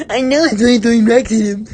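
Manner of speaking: high, loud, fast squawk; put-on bully voice. A narrator's reading. Bertha defends throwing rocks at Grubs, fast high squawk. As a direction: gabble it in a squealing character voice.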